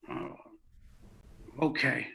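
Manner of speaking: low, hesitant retold okay; reluctant giving in. Clown told to return the rope, mild reluctance. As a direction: pause after the oh, then give in low.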